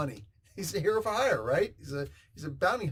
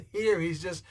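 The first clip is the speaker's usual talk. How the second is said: ordinary talk, nothing marked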